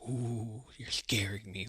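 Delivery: breathy whisper, pretending to be frightened; mock scared whisper. Heel mocking an opponent's threat backstage, teasing fake-scared whisper. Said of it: "he'd be like".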